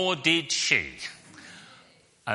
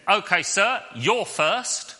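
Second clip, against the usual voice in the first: quizmaster; 6 semitones higher, slower than usual. Retold or imitated. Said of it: "so the quizmaster said".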